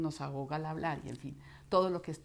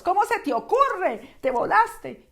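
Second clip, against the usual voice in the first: high, loud furious yell; shrieking road rage; worst-state outburst; 12 semitones higher, 13 dB louder, slower than usual. Acted. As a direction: scream at the driver who hit you. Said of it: "la voz le sale a uno en uno de los peores estados".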